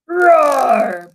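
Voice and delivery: loud high dragon bellow; bellowed roar. Acted. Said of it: "bellowed the dragon".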